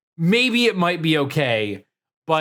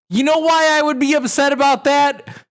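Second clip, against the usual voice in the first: higher, louder heated argument; raised voice; 7 semitones higher, 4 dB louder, faster than usual. Real, not acted. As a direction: push the point loudly.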